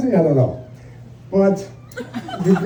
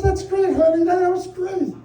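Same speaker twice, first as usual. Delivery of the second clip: higher, patronizing sweetness; husband placating wife before the punchline; humoring; 9 semitones higher than usual. Retold or imitated. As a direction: humor her then deliver the jab.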